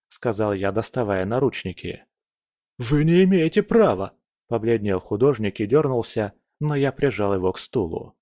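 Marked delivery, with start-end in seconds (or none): 2.8-4.2 loud high protest; outraged shout. A narrator's reading. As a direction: shout it in panic. Arrested man protests.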